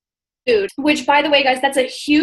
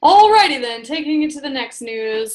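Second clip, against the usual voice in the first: newscaster voice. Pretend. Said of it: "Do I sound like a newscaster?".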